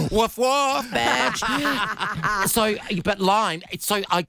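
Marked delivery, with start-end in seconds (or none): 0.1-2.5 high-pitched cartoon dog woofing, played for laughs; mock dog bark. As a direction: bark it like a silly cartoon pup. Jokey bark after Bluey is named.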